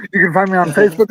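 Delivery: normal talking voice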